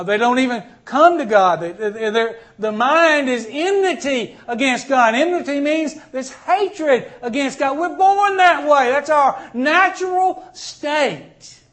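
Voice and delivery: high, loud preaching, slowing at the end; fiery preaching. Acted. Pastor warning the congregation about hell, fiery preaching. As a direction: drive home the doom.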